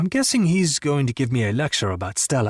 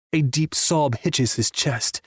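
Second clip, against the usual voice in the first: ordinary delivery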